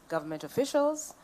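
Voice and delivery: ordinary delivery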